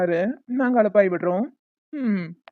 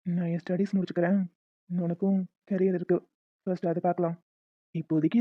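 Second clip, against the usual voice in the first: low, soft and tender; calm her down softly; soft gentle refusal; 5 semitones lower, 9 dB quieter than usual. Acted. Arjun gently telling Kaviya to wait, soft and tender.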